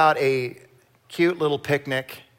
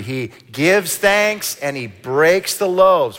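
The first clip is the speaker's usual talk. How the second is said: slow emphatic; 3 semitones higher, 4 dB louder, slower than usual. Shown as a demonstration. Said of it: "Let's say that with me".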